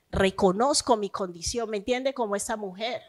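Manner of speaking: normal talking voice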